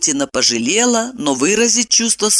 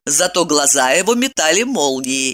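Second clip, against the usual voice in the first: ordinary delivery, nothing marked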